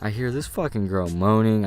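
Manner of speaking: ordinary delivery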